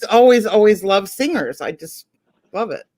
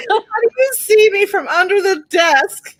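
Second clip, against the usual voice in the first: higher, louder, crying with laughter; laughing; 11 semitones higher, 5 dB louder than usual. Real, not acted. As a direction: squeal it through laughter. Retold quote while laughing.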